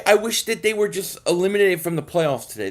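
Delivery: ordinary delivery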